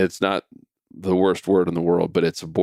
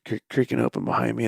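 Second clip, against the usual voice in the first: usual speaking voice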